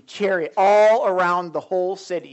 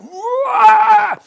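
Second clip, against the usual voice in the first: high, loud mock yell of rage; furious roar; 11 dB louder than usual. King of Aram hearing of Elisha, loud roar. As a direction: let out a big angry roar. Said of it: "So he's like".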